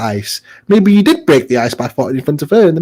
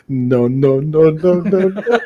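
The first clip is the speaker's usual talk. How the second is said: laughing mock refusal; slower than usual. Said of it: "move backwards, going".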